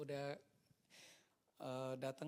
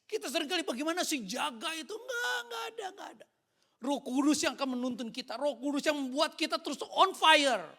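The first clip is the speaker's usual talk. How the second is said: high, very loud pulpit shouting; full-force preaching; 10 semitones higher, 14 dB louder than usual. Real, not acted. Preacher tells church who keeps them on fire, impassioned shouting. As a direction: shout it to the congregation with conviction.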